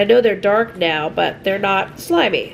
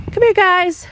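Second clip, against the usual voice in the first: high, louder call to the animals; high, louder call to the pigs; 9 semitones higher, 4 dB louder than usual. Real, not acted. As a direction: call them over across the yard. Calling the pigs.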